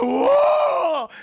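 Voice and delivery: loud high-pitched "whoa" of shock; hammed-up startled shout; shocked yell. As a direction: yelp as if the cup moved by itself. Acting out shock at moving cup.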